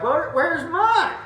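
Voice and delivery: high, slow mock-whiny ask; playful protest. Asking the lady for his own playa kiss, high and slow in mock protest. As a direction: plead for your turn teasingly. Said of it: "And I was like".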